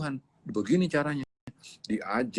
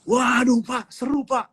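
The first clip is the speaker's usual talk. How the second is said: put-on much higher, eager kid calling Dad; excited child calling; 10 semitones higher, 3 dB louder than usual. Child calling his father after Sunday school, excited and high. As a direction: burst in bubbling with news. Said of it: "dia teriak-teriak ke bapaknya".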